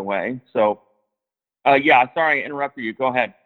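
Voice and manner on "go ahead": usual speaking voice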